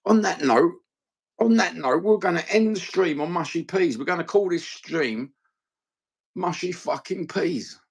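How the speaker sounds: high-pitched, emphatic announcement; hammed-up exasperation; mock indignation. Real, not acted. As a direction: declare it in playful disgust, pitch held high. Mock quarrel over mushy peas.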